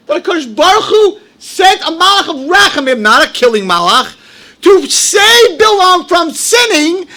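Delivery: high, loud pulpit fervor, slowing on key phrases; fiery preaching. Acted. Rabbi preaching the Rashi to his congregation, at full fervor. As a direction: preach it at full force to the crowd.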